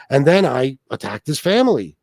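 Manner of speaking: ordinary delivery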